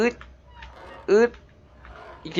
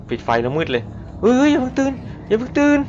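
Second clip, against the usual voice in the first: panicked plea; 11 semitones higher than usual. Real, not acted. Sneaking past sleeping monster.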